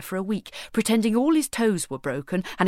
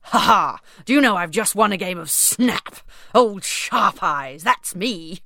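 put-on louder, boastful laughing king, stretching Old Sharp Eyes; gleeful king; 5 dB louder than usual. King boasting to the queen about winning Snap, loud and gleeful. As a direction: boast like a child who won.

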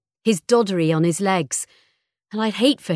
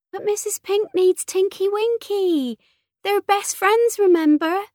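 put-on very high, babyish wheedling; childish pleading daughter; 4 dB louder than usual. A narrator's reading. Daughter begging for both toys, high childish voice. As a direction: wheedle like a little girl, pitched right up.

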